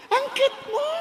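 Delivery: put-on high, cooing baby talk; new mother cooing over her baby, high. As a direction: gush over the newborn.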